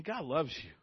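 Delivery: ordinary talk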